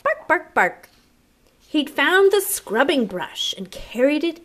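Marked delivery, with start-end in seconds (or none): 0.0-0.5 happy barks. Acted. Harry barking happily by the hole, short high barks. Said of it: "barking short, happy barks". 0.5-0.9 one loud short dog bark; a single happy bark. Acted. Harry finishing his happy barks, a loud single bark. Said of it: "barking short, happy barks".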